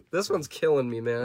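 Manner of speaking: usual speaking voice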